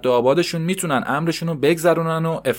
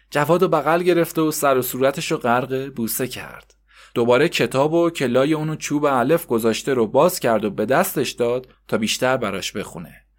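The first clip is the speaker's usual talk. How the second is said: ordinary delivery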